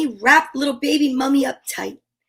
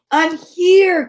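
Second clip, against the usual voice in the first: 1 calling out; 5 semitones higher, 5 dB louder than usual. Acted. Mama calls out to child.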